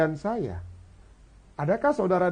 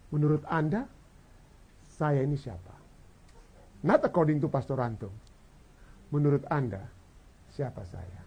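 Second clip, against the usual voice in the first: Jesus asking quietly; 6 semitones lower, 9 dB quieter than usual. Acted. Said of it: "Jesus would like to know".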